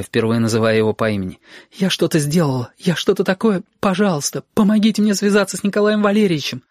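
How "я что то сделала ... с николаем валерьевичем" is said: высоко, сбивчиво, почти в слезах; умоляй, теряя самообладание; паническая мольба. Acted. Сашка в панике просит помощи. (испуганная мольба в трубку)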